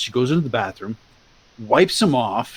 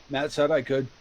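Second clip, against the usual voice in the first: usual speaking voice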